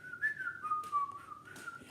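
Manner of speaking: high, soft whistled tune; zookeeper whistle. Shown as a demonstration. Reader showing children a zookeeper, whistling. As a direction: whistle a little wandering tune. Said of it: "Do you know how to whistle like a zookeeper".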